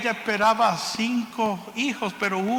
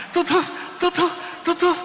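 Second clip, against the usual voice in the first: imitated heartbeat; 8 semitones higher than usual. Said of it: "un segundo corazón latiendo".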